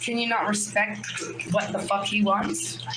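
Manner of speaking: ordinary talk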